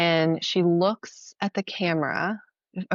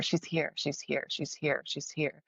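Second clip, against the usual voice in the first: softly repeated; 8 dB quieter than usual. Retold or imitated. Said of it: "kept saying over and over".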